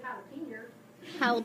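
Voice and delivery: put-on drawn-out Southern-accented jalapeno; slow, mock Southern pronunciation; teasing Southern accent. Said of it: "I don't have a Southern accent".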